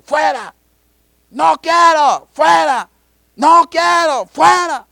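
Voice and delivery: high, full-force screamed outbursts; possessed shouting. Retold or imitated. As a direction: shriek each phrase like a demoniac resisting. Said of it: "empieza a pegar gritos el endemoniado ahí". (gritos de endemoniado)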